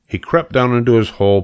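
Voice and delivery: usual speaking voice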